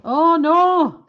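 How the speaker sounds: loud, high dismayed cry; startled. Real, not acted. Streamer notices the camera slipped, loud and high. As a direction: blurt out in alarm.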